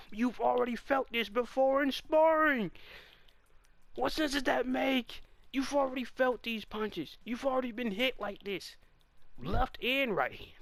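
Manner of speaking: a fan yelling at the fighter; exasperated scolding. Real, not acted. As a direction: chew him out for forgetting. Scolding the character loudly.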